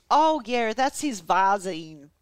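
put-on American Southern accent. Podcaster quoting the wife under police questioning, American Southern accent. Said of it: "tired of doing the accent".

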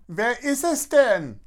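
high-pitched bawled question; high, whiny kid yelling through the house; bawling. A narrator's reading. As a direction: bawl it from another room. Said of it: "Leon plärrt aus dem Badezimmer".

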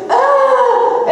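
very high mock scream; imitated child's scream. Caught boy screams at being found with the muffin, mock scream. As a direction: imitate a startled kid's high-pitched scream. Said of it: "And he screams".

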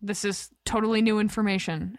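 usual speaking voice

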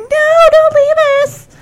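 high pleading fan whine; whining fangirls. Retold or imitated. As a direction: wail like abandoned fans. Girls wailing as Rick leaves.

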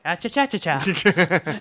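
mock jaunty soda jerk. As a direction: toss off a cheery nonsense rhythm. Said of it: "Yeah, like".

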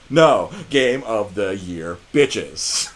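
slow, punched-out declaration; triumphant verdict. Real, not acted. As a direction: lay it down slowly, word by word.

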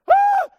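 very high, very loud comic scream; shriek. Retold or imitated. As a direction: scream like a terrified teenager. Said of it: "The Bible doesn't say he screamed".

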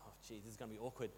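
very soft, low, hurried mutter; awkward aside. Retold or imitated. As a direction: mutter it privately, acting out awkward unease. Retold awkward thought during silent prayer.

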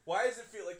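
loud, high frustrated yelling; angry rant. Retold or imitated. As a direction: vent at them, fed up. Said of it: "I was just like".

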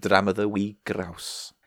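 slow mock Scottish accent. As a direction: draw out a spoof Scottish accent. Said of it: "how you start a Scottish accent impression".

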